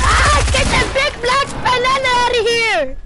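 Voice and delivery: high, loud yell; hyped gamer bro. Real, not acted. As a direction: shout the enemy off the screen. Yelling at enemy.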